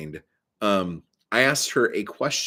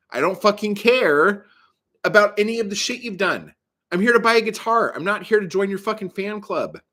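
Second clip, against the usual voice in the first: raised, high-pitched indignant outburst; exasperated rant; 7 semitones higher, 4 dB louder than usual. Acted. Customer ranting at a boastful guitar salesman, exasperated. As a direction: tell off the show-off, voice pushed up.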